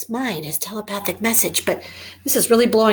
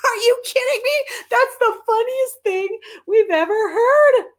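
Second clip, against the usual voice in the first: mocking dogs; 12 semitones higher, 4 dB louder than usual. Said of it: "And they're like".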